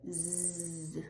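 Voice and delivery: buzzing Z; voiced Z. Shown as a demonstration. Teacher isolating the voiced Z. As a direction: buzz the Z.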